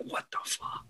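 whispered, voiceless mock reaction; hushed disbelief. Reacting to a lawyer's changed signs, hushed disbelief. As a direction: say it under your breath, baffled.

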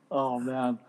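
usual speaking voice

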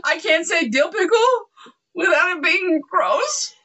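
laughing at herself. Real, not acted. Laughing about the innuendo.

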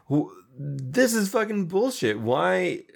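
replaying your own fury at the plan; fed-up, indignant retelling of a protest; angry outburst. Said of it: "I was mad that Greenhill".

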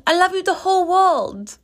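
put-on very high little-boy voice; high-pitched childish gush; seven-year-old nephew. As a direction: imitate a small child declaring huge love. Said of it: "yes, that's how he talks".

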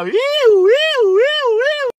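high, loud wailing siren imitation; vocal mimic of a siren; police siren. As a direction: wail like a patrol car switching on. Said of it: "cuando prende la sirena".

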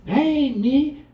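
put-on high, loud sulky child; high loud child protest; denying child. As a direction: squeak out a kid's denial.

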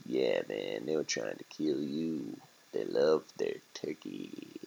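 low, soft, streetwise rat voice; laid-back rat. Acted. Rat telling a turkey hard facts, low and laid-back. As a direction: talk low and casual as a rat.

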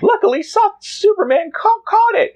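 very high squeaky reporter voice; high Jimmy voice. Acted. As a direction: pitch way up, boyish and eager. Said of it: "it should be Jimmy, uh, saying".

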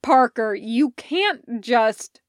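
loud, slowed protest; exasperated protest. Acted. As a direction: stop her mid-thought. Elliot protests.

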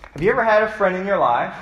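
ordinary delivery

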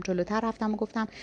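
usual speaking voice

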